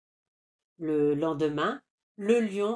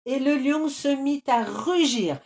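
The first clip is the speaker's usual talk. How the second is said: narration forte; 7 semitones higher, 5 dB louder than usual. A narrator's reading. Le narrateur amplifie le rugissement.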